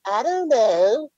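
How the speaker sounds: high-pitched shrug. Retold or imitated. Upset boy answering a TV reporter, high drawn-out shrug. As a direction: stretch it out high, like a clueless kid.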